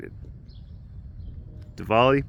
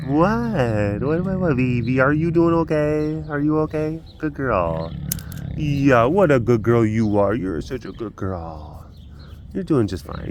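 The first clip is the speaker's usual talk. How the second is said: cooing pet talk; 2 semitones higher than usual. Real, not acted. Owner reassuring the cat, cooing and singsong. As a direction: coo sweetly to an animal.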